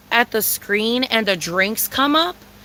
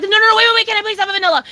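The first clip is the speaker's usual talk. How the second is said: high, frantic interrupting plea; acted-out desperate begging; 10 semitones higher, 4 dB louder, faster than usual. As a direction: cut in loudly in a panic. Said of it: "like, desesperada, way".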